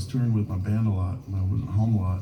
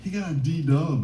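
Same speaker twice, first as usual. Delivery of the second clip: higher, slow mock announcement; amazed announcement; 6 semitones higher, slower than usual. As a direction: spread astonishing news to the whole room. Inmate announces news to the crowd.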